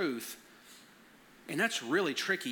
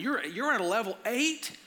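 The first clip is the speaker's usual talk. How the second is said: raised pitch climbing into a question; mock disbelief at the rating; incredulous dad; 6 semitones higher, 3 dB louder than usual. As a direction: question it like it's an alarm. Dad reacting to daughter's stress rating.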